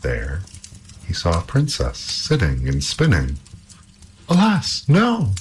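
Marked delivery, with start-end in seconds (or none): 4.3-5.3 high-pitched cry of dismay. A narrator's reading. Startled princess to the stranger at her door, dismayed cry. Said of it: "cried she".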